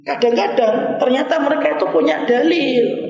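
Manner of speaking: high, loud, fast-driving sermon voice; full-force preaching. Acted. Preacher warning the congregation about shirk, loud and fervent.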